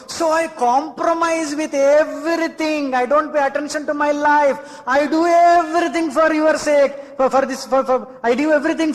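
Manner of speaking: high, drawn-out mock martyr's lament; slow, sing-song show of self-sacrifice; self-sacrificing plaint. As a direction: stretch it out high, pleading your sacrifice. Acts out a self-sacrificing giver.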